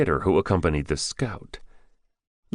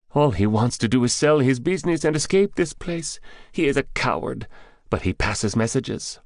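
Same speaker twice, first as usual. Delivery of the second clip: high-pitched, derisive underling; high character voice with contempt; scornful captive; 8 semitones higher than usual. Acted. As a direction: scoff at the coward, pitched up. Captive's figure in narrated story.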